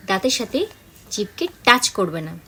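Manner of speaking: ordinary delivery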